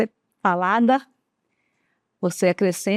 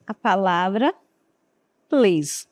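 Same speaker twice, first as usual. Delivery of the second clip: ordinary talk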